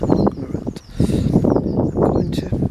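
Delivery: breathy whisper to herself, slowing down; whispered aside. Real, not acted. Calming herself after the EU rant, whispering. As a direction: hush it as a private aside. Repeats promise under her breath.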